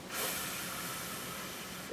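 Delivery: soft, slow, breathy f; quiet f sound. Shown as a demonstration. Teacher shows children the quiet f sound. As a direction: let the f hiss out quietly. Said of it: "It's kind of a quiet sound".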